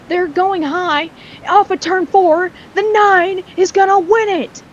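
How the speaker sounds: high, excited race call building to the win; excited play-by-play. Real, not acted. Race commentator calling the final lap to viewers, excited play-by-play. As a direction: call a close finish with rising urgency. Calls the race finish live.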